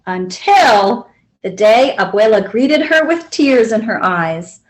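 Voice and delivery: plain reading voice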